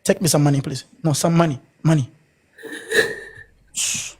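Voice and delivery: ordinary delivery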